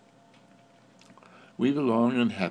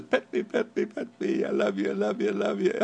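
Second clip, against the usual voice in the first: eager dog's voice; 9 semitones higher, faster than usual. Pretend. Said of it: "puts his head between my legs and says".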